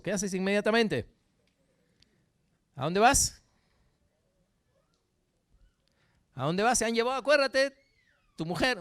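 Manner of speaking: loud, high, driving sermon voice; fiery preaching. Acted. Preacher pushing the church to picture David rushing off, loud and high. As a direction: press the crowd hard with every question.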